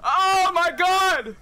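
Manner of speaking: loud, high victory screams; excited shouting. Real, not acted. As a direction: yell it out in triumph. Big win celebration.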